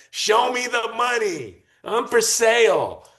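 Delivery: high, slow, drawn-out mock pitchman; Tim Dillon impression. Guest quoting a comedian to the host, in a slow high mock voice. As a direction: stretch it out like a huckster selling himself. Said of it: "as Tim Dillon said".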